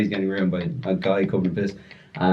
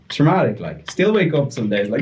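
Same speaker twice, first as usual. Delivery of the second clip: ordinary talk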